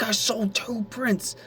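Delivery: high, slow gleeful announcement; excited. Retold or imitated. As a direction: stretch out the happy news. Telling boss the news.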